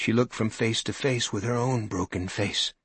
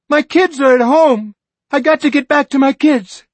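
much higher, louder frantic plea; panicked scream; 14 dB louder than usual. Acted. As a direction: scream about the kids at home. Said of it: "a woman screamed back".

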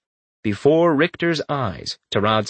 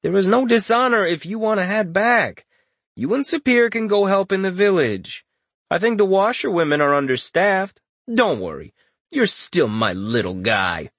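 oozing understanding; 8 semitones higher than usual. Acted. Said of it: "his voice still oozing understanding".

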